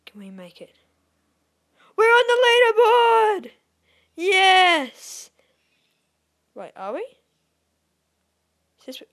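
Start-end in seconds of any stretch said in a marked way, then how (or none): 1.9-5.3 very loud, high, drawn-out cheer; triumphant yell. Real, not acted. Gamer finally making the leaderboard, triumphant yell.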